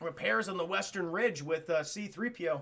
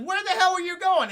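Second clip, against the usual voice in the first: high, loud exasperated call; mock yell; 10 semitones higher, 8 dB louder than usual. Luke shouting at the fleeing droid, high and loud. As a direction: yell after the runaway droid.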